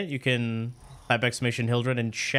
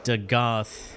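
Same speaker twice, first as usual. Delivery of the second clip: ordinary talk, nothing marked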